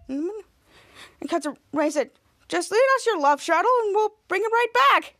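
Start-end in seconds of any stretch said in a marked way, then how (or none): 2.5-5.2 higher-pitched bargaining; acted eager request. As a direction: lightly imitate a pressing request.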